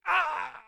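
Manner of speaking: high, loud acted scream; scream of pain. Mayor falls off the edge, screaming in pain. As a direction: cry out as if hitting the ground. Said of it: "you hear him screaming out in pain".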